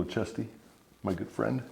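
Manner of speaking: ordinary talk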